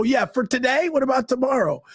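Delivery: high-pitched, pushed reenacted comeback; upset retort. Actor retells challenging the promise of the part, upset retort. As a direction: throw the question back high, mimicking your upset self. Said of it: "'Cause I was so upset".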